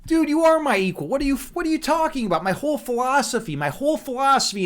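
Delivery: incredulous protest. Real, not acted. Streamer rebutting a viewer who felt unequal, incredulous.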